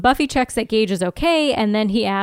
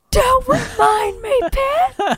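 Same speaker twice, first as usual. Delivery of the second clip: high, drawn-out wail of protest; mock anguished outburst; 4 dB louder, much slower than usual. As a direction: cry out as if tormented by a craving. Mock outburst over craved popcorn.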